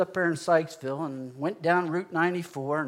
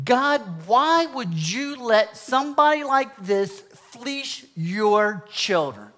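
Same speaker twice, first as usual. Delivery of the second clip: raised, drawn-out protest; angry at God; 6 semitones higher, 4 dB louder, much slower than usual. Retold or imitated. Angry believer crying out to God about crooked preachers, raised voice. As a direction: demand an answer, stretching the words. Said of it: "I was angry with God".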